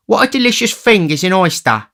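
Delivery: high, loud, self-satisfied oaf; high-pitched greedy character voice; tipsy relishing youth. A narrator's reading. Tipsy Noah relishing an oyster.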